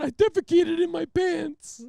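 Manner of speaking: very high, slow, loud wail; tearful repeat. Retold or imitated. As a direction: wail it out word by word. Repeats confession to trooper, crying.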